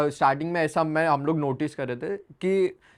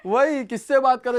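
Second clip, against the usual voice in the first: laughs up high, then repeats the line laughing; 8 semitones higher, 4 dB louder than usual. Real, not acted. Husband laughs retelling his line to the silent wife, laughing. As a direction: breaks up at his own joke.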